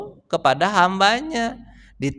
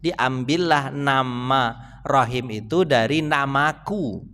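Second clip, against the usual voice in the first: solemn quoting; 5 semitones lower, 4 dB quieter, much slower than usual. Acted. Quoting Allah's words.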